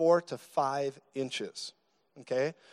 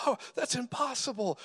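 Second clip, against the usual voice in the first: mock disbelief; 8 semitones higher, 4 dB quieter than usual. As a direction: imitate an incredulous onlooker in a high voice. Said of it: "It's their unbelief that says".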